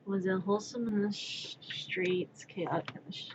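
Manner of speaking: trailing off into a whisper; fading whisper as the joke collapses. Real, not acted. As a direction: let it die away, soft and voiceless.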